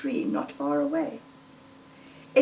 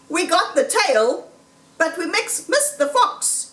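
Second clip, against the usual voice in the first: higher, louder farmer voice, half-pleased; Bean's gruff gloat; 7 semitones higher, 3 dB louder than usual. Acted. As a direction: crow over the tail, then sour. Farmer Bean voiced after shooting.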